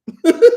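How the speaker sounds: quick high laugh burst; a brief laugh at herself; short real laugh. Real, not acted. Laughs after emotional quote.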